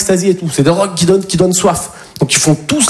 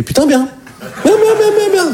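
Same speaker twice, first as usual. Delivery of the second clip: much higher, laughing delight; 14 semitones higher than usual. Retold or imitated. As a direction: gush with glee at the hug. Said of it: "Je dis".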